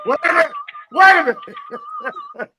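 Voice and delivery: laughing shriek. Real, not acted. Laughing shock at the joke.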